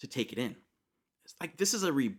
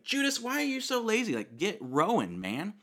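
high, loud, nagging jab at a lazy rowing mate; mock exasperated disciple; 11 semitones higher, 3 dB louder than usual. Disciple scolding Judas at the oars, mock exasperated. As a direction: needle him like a fed-up teenager.

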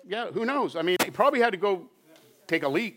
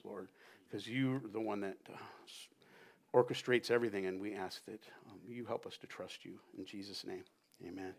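closing prayer; 4 semitones lower, 5 dB quieter than usual. Acted. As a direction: pray quietly to the Lord, drawing down. Pastor closes in prayer.

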